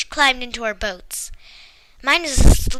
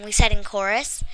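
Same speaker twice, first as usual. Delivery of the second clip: usual speaking voice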